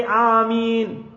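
long drawn-out AMEEN on a held note; congregational ameen. Retold or imitated. Voicing the congregation answering a dua, drawn-out AMEEN.

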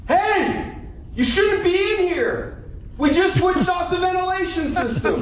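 ignores the note and shouts it; still yelling. Acted. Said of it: "Does he have a hearing problem?".

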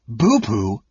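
astonished. A narrator's reading. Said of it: "Caramon repeated in astonishment".

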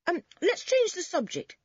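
high, hurried peacemaking; hasty interjection. A narrator's reading. Boy heads off a quarrel, hastily. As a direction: jump in to smooth things over. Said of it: "said Dick hastily".

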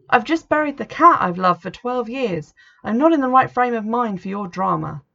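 grieving daughter turning on her mother; upset outburst. A narrator's reading. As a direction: snap back at mum, worn raw. Hurt daughter snaps at mother.

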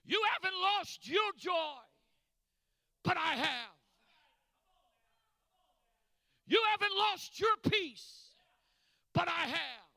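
punched phrases with falling answers; full-force preaching. Real, not acted. Preacher voicing the man who lost his joy, impassioned preaching. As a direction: hurl each line out, then drop on the reply.

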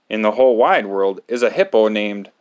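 plain reading voice

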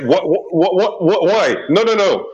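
high, frantic stammered what what what; shocked and pleading, voice climbing; panicked protest. Acted. Player's character protesting the rat kill, high frantic pleading. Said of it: "But I'm like".

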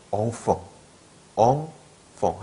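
엉펑 as "ong-fong", both vowels nasal; correct French pronunciation. Shown as a demonstration. Teacher prescribing French enfant, 엉펑 as "ong-fong". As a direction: say 엉펑 with rounded vowels and f. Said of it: "엉펑 하셔야 돼요".

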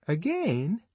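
high disapproving again; pained astonishment; shocked. Acted. Fanny shocked at Lenina, high.

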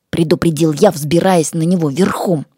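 plain reading voice

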